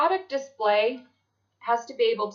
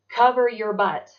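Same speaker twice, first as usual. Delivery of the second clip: louder warning; 8 dB louder than usual. Shown as a demonstration. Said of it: "repeats the warning".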